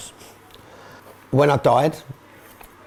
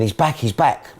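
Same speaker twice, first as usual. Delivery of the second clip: ordinary talk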